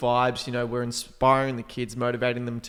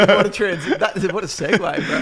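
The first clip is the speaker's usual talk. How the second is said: laughing; 7 semitones higher, 6 dB louder than usual. Real, not acted. Laughing at the awkward segue.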